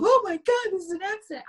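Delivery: high, hammed-up cry of alarm; mock panic. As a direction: parody the old panicked victim. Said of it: "fallen into the trope of".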